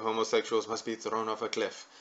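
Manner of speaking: lightly put-on Arabic accent; a hint of a fake Arabic accent. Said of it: "That's my little fake Arabic accent".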